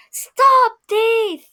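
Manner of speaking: loud, high shout of an angry gardener; calling out. Acted. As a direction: bellow it after the fleeing thief. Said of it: "waving a rake and calling out".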